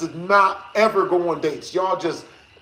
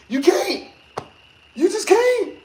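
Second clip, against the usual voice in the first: emphatic; 12 semitones higher than usual. Real, not acted. Warning viewers, high emphatic insistence. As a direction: plead it to the audience.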